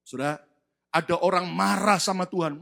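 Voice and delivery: normal talking voice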